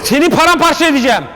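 high-pitched fighting boast; worked-up mock menace; acted threat. Acted threat to beat Rex.

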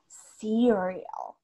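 Cereal stretched, SEE-ree-ol with a falling end; English intonation. Shown as a demonstration. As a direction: give Cereal an English lilt, long first syllable. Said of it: "darle ese tonito English".

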